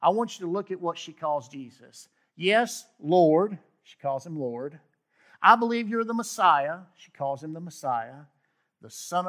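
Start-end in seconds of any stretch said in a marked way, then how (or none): 2.4-3.6 fervent confession. Acted. Preacher voicing Martha's confession. 5.4-6.8 fervent confession. Acted. Preacher voicing Martha's confession.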